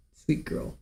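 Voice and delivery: breathy whisper to the pet; whispered to dog. Real, not acted. Owner praising her dog Super Ball, soft whisper.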